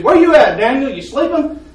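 calling out. Retold or imitated. Brother calls out in the shop.

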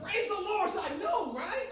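high, loud shout of praise; jubilant praise. Retold or imitated. Preacher reliving the answered prayer, jubilant shout. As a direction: shout it out in triumph. Acted joy at the news.

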